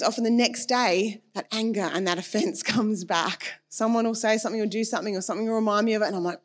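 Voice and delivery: ordinary talk, nothing marked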